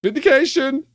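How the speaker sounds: high-pitched victory cry; triumphant crowing. Real, not acted. Host proven right by the clip, triumphant crowing. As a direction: crow it high, savouring the win. Crows after winning the argument.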